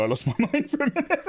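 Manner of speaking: high, fast laughing voice. Real, not acted. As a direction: laugh through the line.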